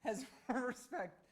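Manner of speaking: high, laughing Trump quote; laughing through it. Retold or imitated. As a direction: crack up mid-line. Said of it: "You can't even keep a straight face".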